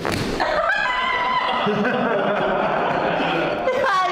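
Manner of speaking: very high, loud laughing shriek; real startled laugh-scream. Real, not acted. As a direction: shriek then laugh at the surprise. Patient shocked by a surprise crack.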